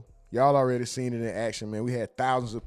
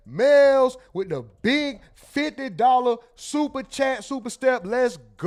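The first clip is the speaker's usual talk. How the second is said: much higher and louder, slowed hype call; hype shout; 12 semitones higher, 9 dB louder, slower than usual. Real, not acted. Celebrating a fifty-dollar donation from Mel, hype shouting. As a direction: yell it out like a hype man.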